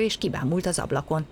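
ordinary delivery, nothing marked

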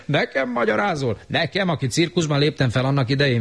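high snippy scoff; scoffing retort. Acted. Siegfried scoffing back at Romó. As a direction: toss it off disdainfully. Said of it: "vetette oda".

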